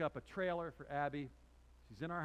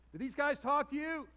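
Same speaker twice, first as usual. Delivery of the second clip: high, loud, excited holler; hammed-up loud asking; retold eager question; 11 semitones higher, 10 dB louder than usual. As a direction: re-enact it as an over-eager shout. Retells his eager question to woman.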